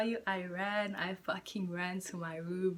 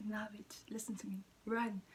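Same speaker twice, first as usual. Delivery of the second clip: quick whispered warning; hushed insistence; 7 dB quieter than usual. Retold or imitated. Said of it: "And you're like".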